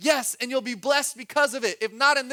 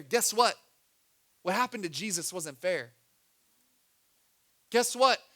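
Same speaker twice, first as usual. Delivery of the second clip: usual speaking voice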